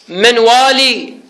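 slow "والي" ending on a long ee; final yaa sounded. Shown as a demonstration. Teacher dictates the stopping form to students, "والي" with long final ee. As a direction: pronounce the yaa at the end of "والي". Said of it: "بالياء".

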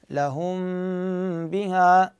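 لهم بها with the m of لهم hummed long on lightly touching lips; concealed meem with ghunnah. Shown as a demonstration. Teacher demonstrating the lip touch to students, long hummed meem. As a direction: stretch the meem in لهم, then open into بها. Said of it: "هذه الميم أخفيها بالباء".

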